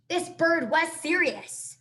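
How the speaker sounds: mock-serious slow warning; emphatic aside. A narrator's reading. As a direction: lean into it, this bird means business. Narrator emphasis.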